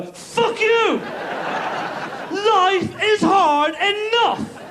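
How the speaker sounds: high, slow comic yell; mock outburst. Worker to pranksters, high slow mock yell. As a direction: fling it at the pranksters.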